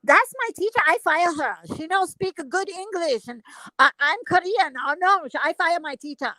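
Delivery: put-on high-pitched broken English in a Korean accent; squeaky mimicry of a Korean woman's accent; mock Korean accent. Retelling the nail tech in her accent.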